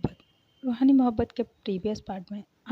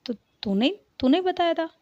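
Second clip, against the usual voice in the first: sharp, higher and louder on बताया; startled question; 8 semitones higher, 5 dB louder than usual. Acted. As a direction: caught off guard, she fires it back. Mother caught out, startled question. (चौंककर, ऊँची और तेज़ आवाज़ में सवाल)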